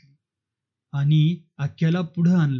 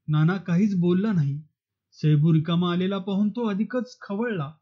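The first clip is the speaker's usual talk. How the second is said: usual speaking voice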